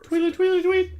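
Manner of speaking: high, sing-song chirping voice; playful high tweet-tweet; mock bird chirp. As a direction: chirp it like a little bird teasing. Mock bird tweeting Twitter joke.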